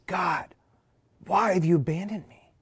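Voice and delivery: whispered, breaking plea to God; hushed cry of abandonment; anguished whisper. Acted. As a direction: breathe it out like a man dying. Jesus crying out on the cross.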